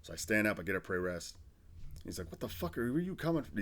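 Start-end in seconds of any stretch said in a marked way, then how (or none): 2.3-3.6 higher-pitched retold interrogation; imitated grilling. Sergeant questions the new arrival, imitated grilling. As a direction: demand an answer, playing the sergeant. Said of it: "He's grilling me".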